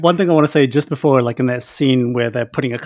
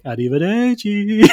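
slow, higher Arrivederci, drawn out; mock Italian goodbye; 6 semitones higher, slower than usual. Donnie leaving the Germans, slow mock Arrivederci. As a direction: stretch Arrivederci like a bad Italian. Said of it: "Donnie Donowitz is like".

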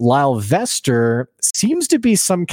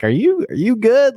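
much higher, hammed-up alarmed concern; mock concerned crowd; 12 semitones higher than usual. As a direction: play the crowd startled by his outfit. Crowd worried at bad outfit.

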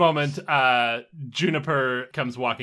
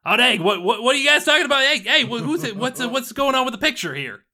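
put-on loud nosy kid; 12 semitones higher, 7 dB louder than usual. GM voices Dexter barging in.

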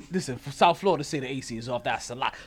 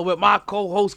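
ordinary talk

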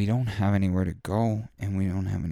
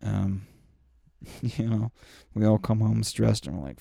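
ordinary delivery

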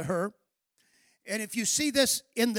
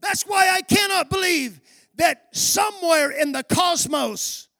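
loud, ringing sermon delivery building to shouts; full-force preaching; 3 semitones higher, 6 dB louder than usual. Acted. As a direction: preach it out to the congregation.

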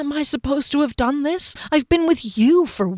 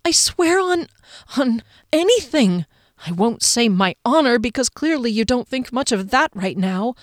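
imploring oath; 6 semitones higher than usual. A narrator's reading. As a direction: swear on everything, begging to be believed.

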